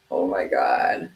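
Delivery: smoker's rasp; low, slow, raspy; chain smoker voice. Acted. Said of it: "Said I'm the chain smoker voice".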